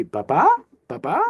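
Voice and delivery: "ba-ba" with the second ba lifted upward; rising acute accent. Shown as a demonstration. Teacher shows students the acute accent, "ba-ba" rising at the end. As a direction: go up on the last ba of "ba-ba". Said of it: "That's what would be done to your voice".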